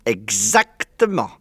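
slow, drawn-out word; slow, emphatic confirmation; savouring confirmation. A narrator's reading. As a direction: stretch it out with relish. Fox confirms the cub's discovery.

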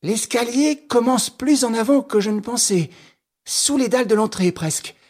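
high-pitched voice of someone reporting a find; excited investigator. Acted.

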